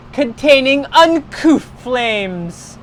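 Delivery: plain reading voice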